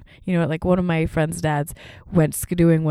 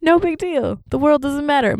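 much higher, breezy shrug of a voice; carefree parents; 9 semitones higher, 4 dB louder than usual. Retold or imitated. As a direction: wave it all off. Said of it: "They're like".

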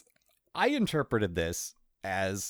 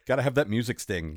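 usual speaking voice

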